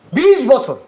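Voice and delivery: "বিশ" punched high and loud; angry emphasis. Acted. Brother stressing his twenty years abroad, angry emphasis. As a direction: hit "বিশ" hard, then let the pitch drop.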